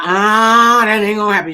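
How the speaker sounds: loud dismissive refusal; loud, scoffing refusal; loud defiant scoff. Retold or imitated. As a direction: shut the idea down hard. Defiant retort retold.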